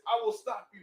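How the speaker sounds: high, loud defiant vow; defiant hero. Acted. Injured hero defying the storm girl, loud. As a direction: grit it out.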